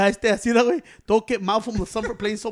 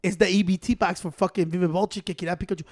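usual speaking voice

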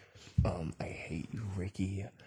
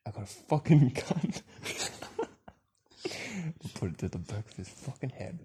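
usual speaking voice

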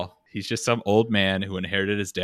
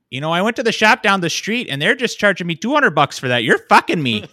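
loud, high southern Minnesota complaint; hammed-up indignant Minnesotan accent; 9 semitones higher, 8 dB louder than usual. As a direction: put on an aggrieved southern Minnesotan. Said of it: "southern Minnesota is a lot more nasally".

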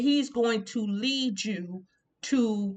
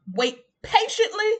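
emphatic high quote; 13 semitones higher, 4 dB louder than usual. Acted. Quoting, voice jumps up.

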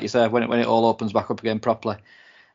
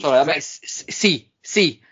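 a hint of put-on Spanish accent; Manuel from Fawlty Towers; 3 semitones higher than usual. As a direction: lightly imitate a comic Spanish waiter. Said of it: "you sound like Manuel from Fawlty Towers".

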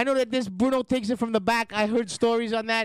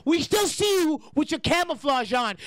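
usual speaking voice

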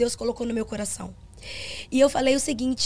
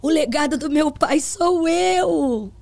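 slow, louder call to the congregation; retold sermon, slow and louder; preaching; 4 semitones higher, 5 dB louder than usual. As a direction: lift your voice to the church. Retells addressing congregation.

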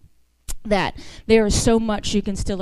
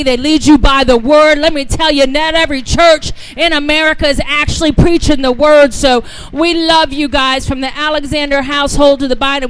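loud, driving preacher cadence, higher; preaching at full force; 4 semitones higher, 10 dB louder than usual. Acted. Guest preacher rallying the church to honor its pastors, preaching loud. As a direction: preach it to the rafters.

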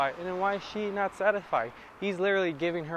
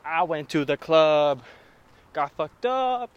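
louder, slow mock party-rap voice; mock club song; 11 dB louder, slower than usual. Mocking club lyrics, much louder. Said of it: "It's not covering with".

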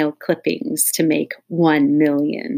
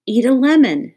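eager; 8 semitones higher, 9 dB louder than usual. A narrator's reading. Child eagerly suggests.

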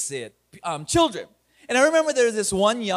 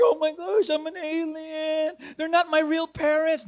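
put-on higher, upset little-girl voice; 7 semitones higher than usual. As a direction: voice a distressed seven-year-old. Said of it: "She's like".

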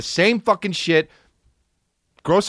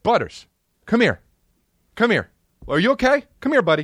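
higher, coaxing call to the dog; 6 semitones higher, faster than usual. Real, not acted. Calling Butters the dog over, coaxing and higher. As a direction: sweet-talk the pet over.

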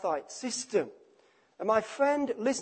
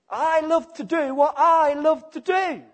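higher and much louder, boldly self-centred; self-willed sinner; 6 semitones higher, 8 dB louder than usual. Acted. Preacher voicing the sinner who loves his own way, loud and defiant.

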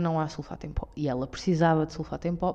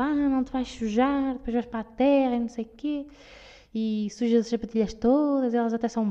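mock fussy mum; 5 semitones higher than usual. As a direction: imitate an overprotective mother warning her child. Mother voiced in a story. (imitação da mãe protetora, aguda e lenta)

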